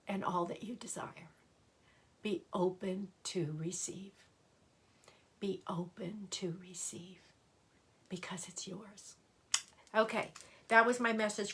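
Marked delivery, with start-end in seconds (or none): 0.1-1.3 soft whispered affirmation; hushed, unvoiced close to the ear. 5.4-9.2 hushed blessing that dies into breath; whispered affirmation, softer to the end. Acted.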